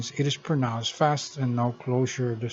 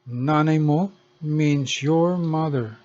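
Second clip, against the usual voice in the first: ordinary talk, nothing marked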